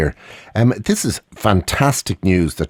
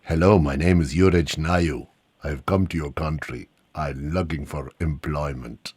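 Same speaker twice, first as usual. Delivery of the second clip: lower, softer foreign newcomer; lightly voiced job-seeking stranger; foreign visitor voice; 7 semitones lower, 6 dB quieter than usual. Jokingly plays foreign newcomer.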